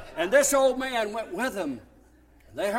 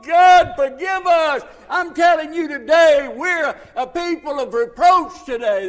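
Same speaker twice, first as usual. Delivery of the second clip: loud, raised-pitch pulpit lament; full-force sermon delivery; impassioned preaching; 3 semitones higher, 7 dB louder than usual. Real, not acted. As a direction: preach it hard, surging to a cry to God.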